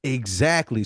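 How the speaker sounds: ordinary talk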